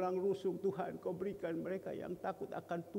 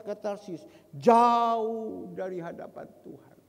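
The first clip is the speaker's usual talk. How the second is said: loud, raised preacher's proclamation; full-force preaching; 5 semitones higher, 10 dB louder than usual. Acted. Preacher reading Jonah's flight to the congregation, full-force preaching. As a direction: declaim the scripture loudly, drawing out key words.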